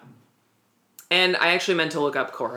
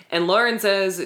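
ordinary delivery

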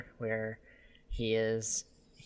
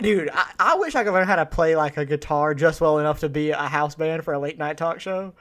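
usual speaking voice

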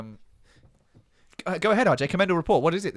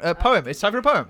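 high, loud segment announcer; 8 semitones higher, 9 dB louder than usual. Pretend. Host announces poem time. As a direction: boom it out like a host.

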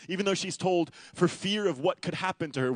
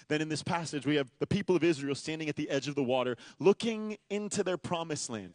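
ordinary delivery

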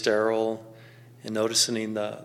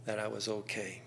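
ordinary talk, nothing marked

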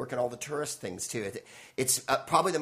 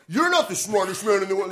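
louder, higher mock heckler voice; 7 semitones higher, 7 dB louder than usual. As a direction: jab it at him like a snide heckler. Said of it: "there's people who go".

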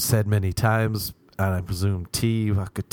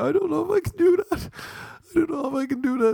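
very high, strained squeaky voice; panicking friend; faster than usual. Retold or imitated. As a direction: squeal out the fear of a cornered kid.

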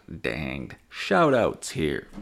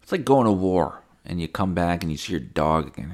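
ordinary talk, nothing marked

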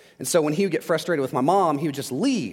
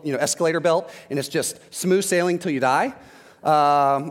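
normal talking voice

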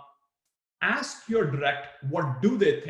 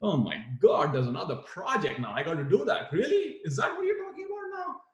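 rising to a much higher, incredulous Really; fed up with another project; exasperated disbelief; 5 semitones higher than usual. Shown as a demonstration. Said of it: "or something like this".

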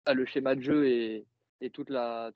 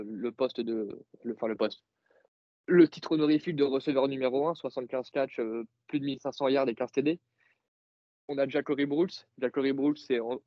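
usual speaking voice

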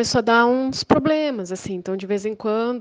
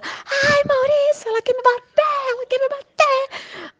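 much higher whimpering mock complaint; mock victim whine; slower than usual. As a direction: play the victim to her husband in a shrill whine. Neighbor whining to husband.

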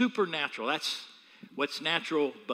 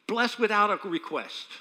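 usual speaking voice

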